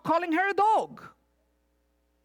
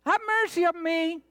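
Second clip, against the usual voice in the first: crying out from afar; 8 semitones higher than usual. Acted. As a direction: call out desperately from a distance. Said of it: "the woman from the distance is saying".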